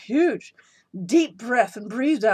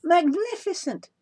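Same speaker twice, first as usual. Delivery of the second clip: high, slow gushing praise; fake awe; 11 semitones higher, 4 dB louder than usual. A narrator's reading. Princess praising the dragon, high and drawn out. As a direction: draw it out in mock wonder. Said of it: "said Elizabeth".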